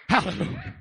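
usual speaking voice

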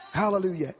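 usual speaking voice

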